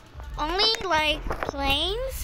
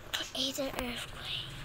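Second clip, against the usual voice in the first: whisper; 3 semitones lower, 12 dB quieter, slower than usual. Real, not acted. Reads button quietly.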